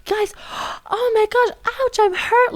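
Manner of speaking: high-pitched mock hysterics; imitating screaming woman. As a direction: play an outraged customer with gasps, hammed up. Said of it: "she was screaming at Raji".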